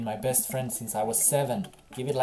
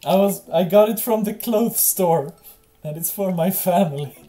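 high, loud, pleading protest to the cops; frantic excuse; 7 semitones higher, 9 dB louder than usual. Acted. Dad insisting to the police it's soup, high, loud, frantic. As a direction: yell it like a caught kid insisting it's soup. Dad's flaming tennis ball excuse.

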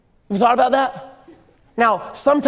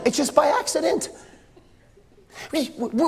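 normal talking voice

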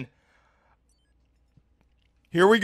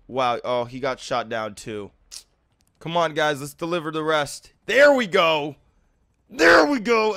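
normal talking voice

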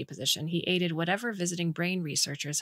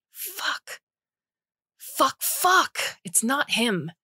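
high, loud frustrated cursing; furious outburst; 8 semitones higher, 4 dB louder, much slower than usual. Acted. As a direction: swear in sudden high fury. Cora's outburst at wrong subject.

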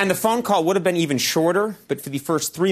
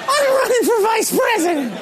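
high, whiny mock-child shout; hammed-up high, loud pleading; John Edwards; 4 dB louder than usual. Acts out John Edwards locked out.

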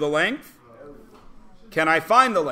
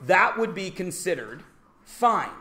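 ordinary delivery

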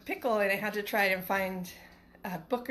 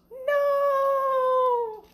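very high, loud squeal; a little critter squeals it; squeaky animal; 8 dB louder than usual. Acted. Second critter in the piano squeals no, very high.

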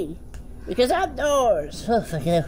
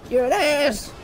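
normal talking voice

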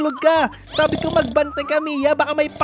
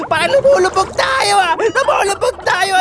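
mock panic; 6 semitones higher, 5 dB louder than usual. Character sinking underwater in game.

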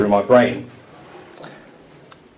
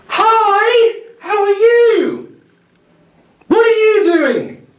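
very high, loud, slow sing-song talking-down voice; high, loud singsong condescension; patronising photographer; 8 dB louder, much slower than usual. Retold or imitated. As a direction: talk to her like a small child, sweetly and slowly.

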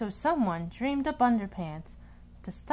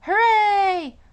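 loud, high cheer of joy; big high whoop of victory; cheering shout; 10 semitones higher, 11 dB louder than usual. A narrator's reading. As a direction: shout it out like a happy caveman. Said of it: "and cheered at what they saw".

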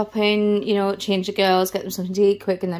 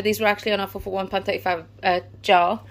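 ordinary talk

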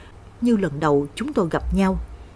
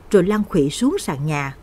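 usual speaking voice

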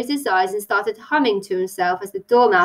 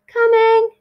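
high, loud, drawn-out call; 13 semitones higher, 7 dB louder than usual. A narrator's reading. Tilly answers Grandma from upstairs, calling out. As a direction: holler back from another floor. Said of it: "Tilly called".

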